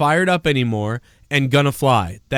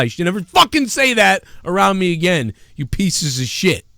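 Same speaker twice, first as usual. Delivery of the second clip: mock angry outburst; 4 semitones higher than usual. Telling friends never to say that around him, mock outrage. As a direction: chew them out in mock fury. Acted rant at friends over catchphrase.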